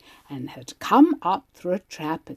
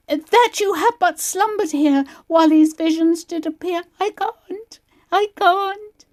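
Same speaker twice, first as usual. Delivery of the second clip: high, loud, pained recitation broken by cries; young actress in pain finishes Puck's speech on stage; 13 semitones higher, 5 dB louder than usual. Retold or imitated. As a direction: push through the lines while in agony. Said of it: "The text ran something like this".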